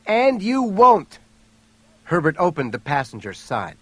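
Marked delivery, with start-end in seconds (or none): 0.1-1.2 high, loud, drawn-out defiant yell; strong-voiced yell. A narrator's reading. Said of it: "she yelled in a strong voice".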